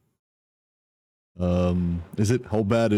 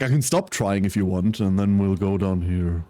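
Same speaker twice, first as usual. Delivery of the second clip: usual speaking voice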